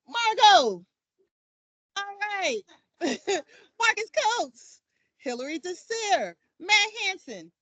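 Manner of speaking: a lightly put-on grown-up voice for the roll call; big girl voice. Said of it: "I can use my big girl voice".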